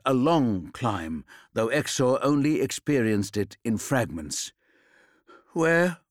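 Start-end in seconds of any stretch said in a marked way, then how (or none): 5.5-6.0 weak croak, pitched higher and a little louder; a dazed man croaking one word. A narrator's reading. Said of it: "he managed to croak".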